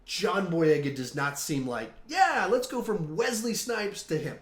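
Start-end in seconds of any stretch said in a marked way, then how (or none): 2.1-4.4 higher-pitched mock cheer; voiced eager reaction; mock enthusiasm. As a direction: imitate someone keen on a bad idea. Voicing an imagined enthusiastic reaction.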